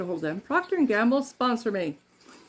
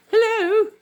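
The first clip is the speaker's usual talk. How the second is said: loud, very high exaggerated British greeting; over-the-top high-pitched British accent; crazy accent; 14 semitones higher, 9 dB louder, slower than usual. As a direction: call out in a hammed-up British accent. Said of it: "get this crazy accent person".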